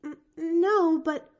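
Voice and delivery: put-on high, faltering girl's stammer; nervous child caught out; hesitant stammer. A narrator's reading. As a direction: stumble over the no. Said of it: "his mouth growing a little stern".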